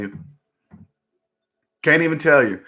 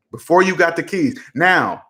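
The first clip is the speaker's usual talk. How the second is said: usual speaking voice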